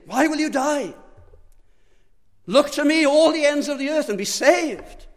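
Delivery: high, loud divine call across the earth; God pleading. Acted. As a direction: cry out as God begging them to live. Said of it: "God is pleading".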